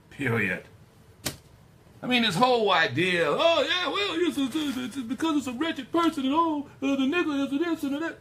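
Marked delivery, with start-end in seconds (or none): 3.3-8.2 high, loud, hammed-up babble; exaggerated mock explaining, racing on excitedly; mocking imitation. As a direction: parody a pompous justifier in a high-pitched voice. Mocking those who rationalise the word.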